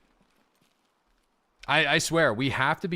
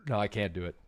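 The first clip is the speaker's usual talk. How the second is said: ordinary delivery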